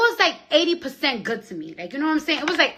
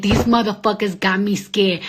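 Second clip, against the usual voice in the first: usual speaking voice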